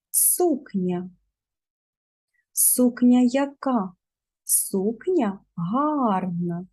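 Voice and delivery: speech therapy drill. Shown as a demonstration. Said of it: "Повторюйте за мною".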